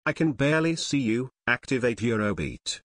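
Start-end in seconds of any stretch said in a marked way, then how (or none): none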